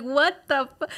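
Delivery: loud, high, laughing indignation; mock outrage. Kicked out by her daughter, a loud mock outrage. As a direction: burst out in disbelief.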